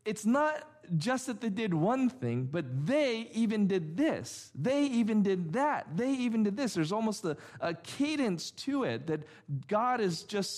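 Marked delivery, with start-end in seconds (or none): 2.5-6.7 "even" raised and pressed in each clause; stress on even. Shown as a demonstration. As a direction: list the offences, leaning on "even". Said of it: "five times to say".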